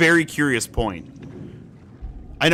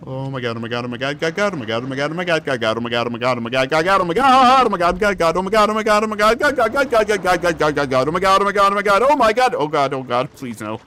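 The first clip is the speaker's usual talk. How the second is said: frantic panicked chanting; being chased; 3 semitones higher, faster than usual. Real, not acted. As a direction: babble it in rising panic. Frantic fleeing from creature.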